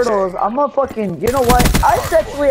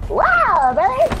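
excited yell; 8 semitones higher, 4 dB quieter, much slower than usual. Real, not acted. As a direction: hype it up like winning. Excited yelling in game.

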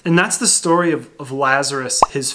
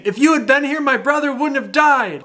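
grieving sister; 10 semitones higher than usual. Acted. Mary repeats the same words.

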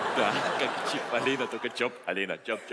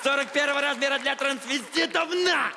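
high drawn-out gloating; slow, gloating, hammy announcement; mock triumphant shout; 8 semitones higher, slower than usual. Guard hurls clothes into cubicle.

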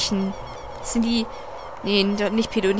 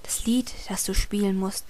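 whispered reading; 3 semitones lower, 7 dB quieter than usual. Acted. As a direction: read it like a secret. Reads game text in whispery voice.

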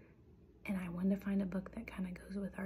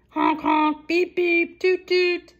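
loud, high honking noises; sustained high toots; imitated car horns; 9 semitones higher, 17 dB louder, slower than usual. A narrator's reading. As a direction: blare out each horn like city traffic.

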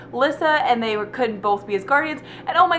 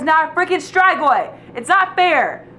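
louder and higher, outraged at the book; yelling; 6 semitones higher, 5 dB louder than usual. Real, not acted. Yelling in outrage about Dimitri becoming Strigoi. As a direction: let it burst out, loud and upset. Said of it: "hear me yelling about this book".

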